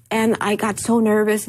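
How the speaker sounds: normal talking voice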